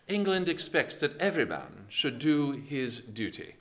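put-on British admiral's voice; British accent. Shown as a demonstration. Nelson signalling his fleet before battle, British accent. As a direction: deliver it in formal British English. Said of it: "oh, I'll do it in British".

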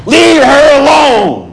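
loud command. Acted. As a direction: say it as Jesus would, loudly. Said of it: "now he probably said this loudly".

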